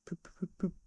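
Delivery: stifle a car horn in your throat; stifled poop noises. A narrator's reading. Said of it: "sounds resembling stifled snorts".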